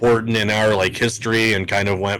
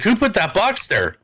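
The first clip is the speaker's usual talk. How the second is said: put-on girlfriend pitched up, eyeing the box; high mock suspicious question; 9 semitones higher than usual. Girlfriend spots the ring box under the tree, high suspicious question. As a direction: ask it higher, like you're suspicious.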